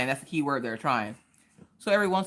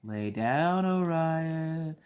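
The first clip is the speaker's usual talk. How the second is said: spooky camera voice; 3 semitones higher, much slower than usual. Retold or imitated. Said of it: "and I was like".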